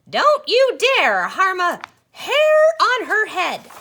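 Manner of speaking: high, loud protective yell; a grandma roaring at the wolf to back off; furious granny shout. A narrator's reading. Gran defends Red from wolf.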